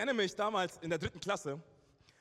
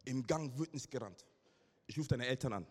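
ordinary talk, nothing marked

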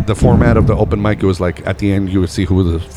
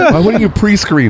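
laughing; 10 semitones higher than usual. Real, not acted. Laughs at radio show.

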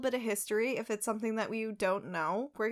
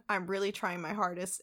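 usual speaking voice

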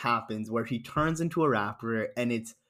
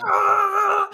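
very high, loud, held yell; hammed-up endless scream; mock movie scream; 8 dB louder than usual. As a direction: imitate two fighters screaming on and on. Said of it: "Why are you screaming like this".

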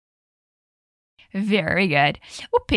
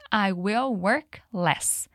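"I will work less" spoken very slowly and evenly; slow model sentence; 2 semitones lower, much slower than usual. Shown as a demonstration. Teacher models "I will work less" for students, very slow. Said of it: "repete comigo".

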